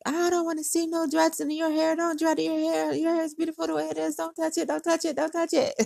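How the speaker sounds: high-pitched, insistent dad voice; imitated protesting dad. Dad objecting to dreads, voiced.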